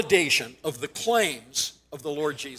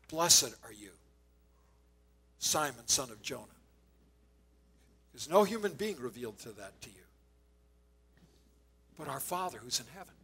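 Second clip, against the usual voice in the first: low, very soft, measured words; tender blessing; 5 semitones lower, 10 dB quieter than usual. Retold or imitated. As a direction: bestow a quiet blessing, leaving space between phrases. Jesus blessing Peter, retold.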